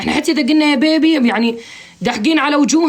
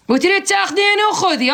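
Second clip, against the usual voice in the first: sarcastic dare; 9 semitones higher than usual. Real, not acted. Sarcastic challenge to caller.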